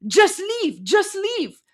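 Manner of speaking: mimicking the crowd chasing him away; mock crowd shouting leave. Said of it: "you hear Iranians, they're like".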